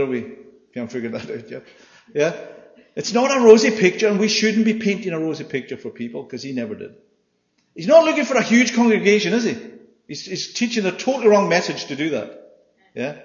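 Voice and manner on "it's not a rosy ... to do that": emphatic preaching. Real, not acted. Preacher driving home his point.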